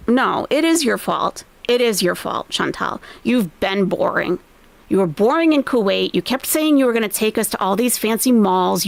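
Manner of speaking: normal talking voice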